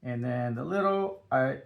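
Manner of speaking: ordinary talk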